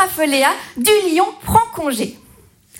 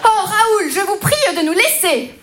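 outraged lion; 6 semitones higher than usual. Acted.

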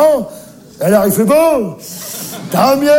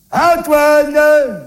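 put-on a long, higher call to a child far off; 7 semitones higher than usual. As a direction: call it out into the distance, stretched. Calling again, further away.

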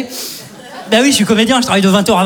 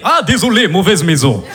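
put-on low Russian-accented apology; 4 semitones lower than usual. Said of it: "Je fais bien l'accent comme ça". (accent russe grave)

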